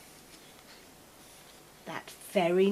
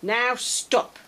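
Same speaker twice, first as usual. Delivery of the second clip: a raised, firm stop; firm order; 4 semitones higher than usual. A narrator's reading. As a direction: end the party with a command. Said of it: "Max said".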